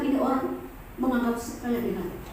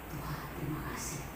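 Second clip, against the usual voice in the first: much lower and much softer; whispered prayer; 8 semitones lower, 15 dB quieter than usual. Retold or imitated. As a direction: pray quietly, moved. Retold tearful prayer after kneeling. (doa syukur yang sangat lirih)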